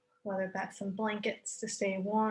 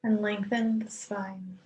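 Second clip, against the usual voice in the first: calm yoga nidra voice; guided meditation. Acted. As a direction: ease listeners into stillness, unhurried. Leads guided relaxation with long pauses.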